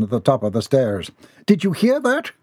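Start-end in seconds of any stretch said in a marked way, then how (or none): 1.5-2.3 high, startled question; high, anxious character voice; alarmed. Acted. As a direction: ask in high-pitched alarm. Startled by scream in mansion.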